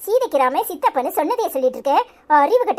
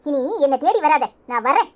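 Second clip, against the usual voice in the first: ordinary delivery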